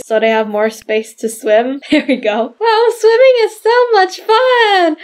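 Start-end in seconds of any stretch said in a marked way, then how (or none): 2.6-5.0 high-pitched gleeful play voice; high, pretend-play cheer; excited doll character. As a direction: squeal with delight for the toy. Voicing doll enjoying swim.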